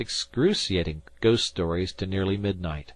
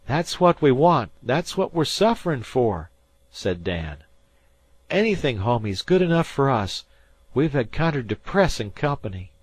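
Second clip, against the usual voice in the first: put-on high, loud boy's voice, insistent; he swears the dead man came back; spooked fisher boy; 6 semitones higher, 4 dB louder than usual. A narrator's reading. Dan to Harvey, spooked high boy voice.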